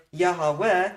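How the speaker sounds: Yahweh as ya-ha-weh, extra middle syllable; three parts. Shown as a demonstration. Teacher posing a hypothetical pronunciation, Yahweh in three syllables. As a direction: put a ha inside Yahweh, three beats. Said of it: "If the true pronunciation in everyday speech were".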